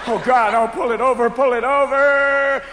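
panicked wail. Acted. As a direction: wail in panic, drawing out the last word. Panicked driver pulled over by police.

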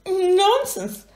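high, loud indignant splutter; high spluttering character voice; spluttering Hamish. Acted. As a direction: stammer out the protest. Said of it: "spluttered Hamish".

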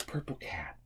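soft unvoiced whisper; hushed guessing-game whisper. Real, not acted. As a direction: breathe the words like a secret.

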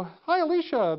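bright, high-pitched hello, acted out; cheery phone greeting. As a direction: greet a colleague like an old friend.

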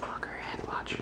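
soft unvoiced whisper. Real, not acted. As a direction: whisper so she won't wake. Whispering near sleeping baby.

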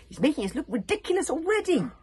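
high, loud outrage; spat savagely. A narrator's reading. Humiliated Baggy Bum leaving the hut, savage outburst. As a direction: spit it out, furious. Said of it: "spat savagely to Nobber No Bones".

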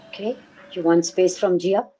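incredulous echo. Retold or imitated. Mother echoes the boyfriend's request in quiet disbelief. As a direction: throw his words back as a stunned question. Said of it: "I said".

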